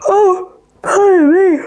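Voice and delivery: talking through a yawn; high, slow words stretched by a yawn; real yawn. Real, not acted. Podcaster apologising for an unexpected yawn, yawning through it. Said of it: "I don't know why I'm yawning".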